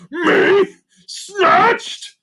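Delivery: high loud mocking cackle; scoffing laugh. A narrator's reading. Creature king scoffs at being snatched, mocking laugh. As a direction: laugh her off in disbelief. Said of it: "the king scoffed".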